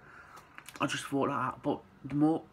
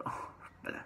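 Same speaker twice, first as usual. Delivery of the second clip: whispered aside; 12 dB quieter than usual. Retold or imitated. Mum hinting, son's retold reaction.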